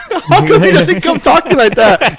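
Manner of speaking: high, slow question through laughter; laughing. Real, not acted. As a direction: crack up while asking. Cracks up at the accent.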